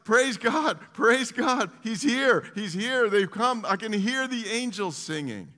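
high, full-voiced shout of praise; yelling. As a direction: yell it out like an overwhelmed witness. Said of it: "She's yelling".

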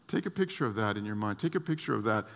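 ordinary talk, nothing marked

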